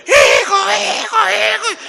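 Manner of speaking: loud, high panicked cries; grandmother's scream. Retold or imitated. Grandmother screaming for her grandson as the bull lands nearby, panicked. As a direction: scream out like a terrified granny, imitated. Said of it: "escuché el grito de mi abuela".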